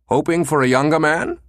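put-on teasing older man, with a chuckle; chuckling commander. Commander teasing Susan on the phone, amused. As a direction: tease her lightly as an amused boss. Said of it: "the voice chuckled".

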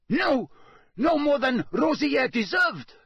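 high, loud frantic plea; panicky. A narrator's reading. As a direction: scramble in panic. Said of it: "a real note of panic in his voice".

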